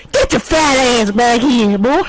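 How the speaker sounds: high, loud, slow creep holler; Herbert the Pervert. Retold or imitated. Cartoon creep chases fleeing player, hollered impression. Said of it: "we made him do Hermit the Pervert".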